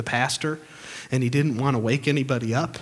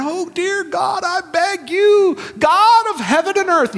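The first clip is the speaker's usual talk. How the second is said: high, drawn-out pious wail; hammed-up begging to heaven, slow and loud; mock pleading prayer; 6 dB louder, slower than usual. Preacher parodies people begging God, mock pleading.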